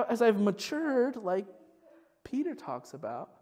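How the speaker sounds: ordinary delivery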